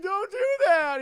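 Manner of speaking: high, loud desperate cry; an over-the-top desperate begging; mock anguished plea. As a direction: plead in a hammed-up panic. Victim pleading for his family.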